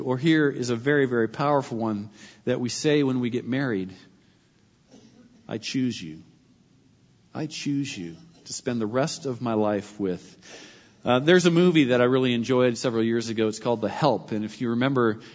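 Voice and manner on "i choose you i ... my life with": softer, lower and hushed; whisper-close, like vows at the altar; tender vow. Shown as a demonstration. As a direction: intimate wedding promise. Wedding vow spoken as example.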